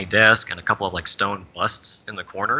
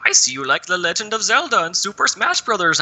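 higher, smug villain voice; gloating psychic villain; 10 semitones higher than usual. Retold or imitated. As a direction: show off the mind reading. Psychic villain reads your mind.

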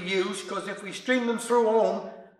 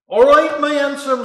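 put-on loud, slurring drunk calling out; slurred drunk; 5 semitones higher, 9 dB louder than usual. A narrator's reading. Drunk stranger at the door slurring a greeting. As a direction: slur it like a tipsy stranger. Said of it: "slurred the stranger".

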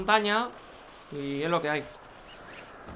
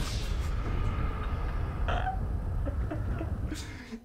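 breathy laugh; 12 semitones higher, 6 dB quieter than usual. Real, not acted. Laughs at falling again.

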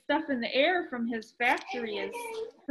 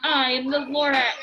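coughing Lorax; 6 dB louder than usual. A narrator's reading. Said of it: "he coughed and he whipped".

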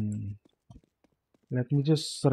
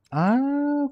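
sudden high ah; 13 semitones higher, 4 dB louder than usual. Real, not acted. Sudden realization.

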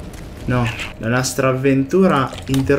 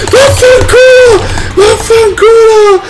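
loud, high, slowing rage; furious screams; 9 dB louder, slower than usual. Real, not acted. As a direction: scream and start to drag it out. Repeated frustrated screams at game.

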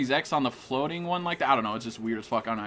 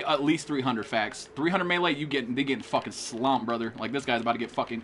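ordinary delivery

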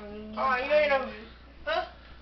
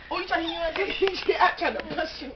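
normal talking voice

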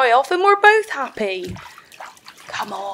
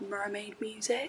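hushed, secretive whisper; 2 semitones lower, 17 dB quieter than usual. Acted. Mermaid answering what she dances to, hushed. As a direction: let it out as a breathy secret.

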